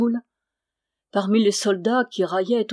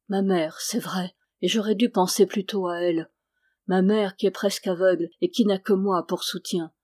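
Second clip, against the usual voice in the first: usual speaking voice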